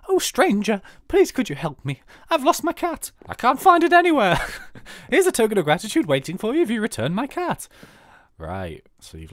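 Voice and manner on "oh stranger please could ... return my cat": high, loud pleading farm lady voice; lady with a lost cat. Acted. The farmer's wife begs the player for help, high and loud. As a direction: beg the stranger to find your cat. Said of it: "let's see what she's gotta say".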